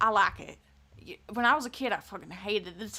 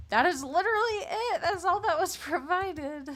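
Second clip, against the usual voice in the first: high, loud, laughing through it; loud incredulous yell; 11 semitones higher, 7 dB louder than usual. Real, not acted. Streamer reacting to the video, incredulous laughing yell. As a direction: shout it in disbelief, half laughing.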